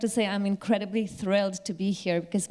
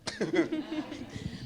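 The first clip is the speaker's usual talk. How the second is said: laugh; 8 semitones higher, 7 dB quieter than usual. Real, not acted. Laughing at the running joke.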